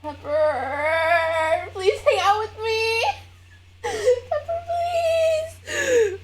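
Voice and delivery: high, whiny begging; pleading with cat. Real, not acted. Begging her cat Pepper to come cuddle, high whine.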